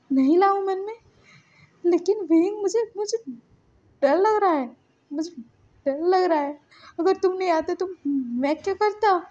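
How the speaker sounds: loud, high, halting plea; acted fear, broken up by pauses; frightened. A narrator's reading. Lanson tells Wing he is scared, pleading in high distress.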